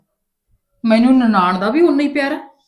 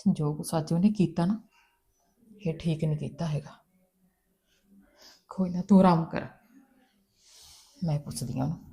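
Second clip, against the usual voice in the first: softer, lower comforting words; gentle consoling; 4 semitones lower, 8 dB quieter than usual. Acted. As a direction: soothe her hurt gently. Sister-in-law consoles upset woman. (ਹੌਲੀ ਪਿਆਰ ਨਾਲ ਦਿਲਾਸਾ)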